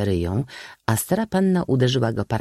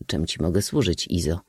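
ordinary delivery, nothing marked

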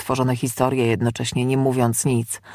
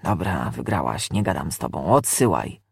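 resigned demon; 10 semitones lower than usual. Acted. Demon gives up arguing with the exorcist, low and resigned.

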